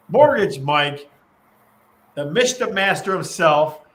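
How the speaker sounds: loud, slow ring-announcer build-up; announcer intro. Acted. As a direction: call him in like a ring announcer.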